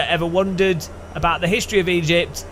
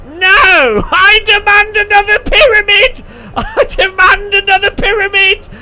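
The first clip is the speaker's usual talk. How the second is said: much higher and louder, slow shrieking demand; tyrant pharaoh; 9 dB louder than usual. Retold or imitated. New leader ordering his people to build more, shrieking. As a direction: screech orders like a spoiled king. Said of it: "the next leader's like".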